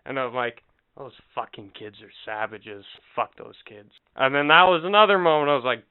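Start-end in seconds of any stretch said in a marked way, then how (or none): 1.0-3.9 low, hushed grumble under the breath; muttered aside. Retold or imitated. Muttered insult to wife.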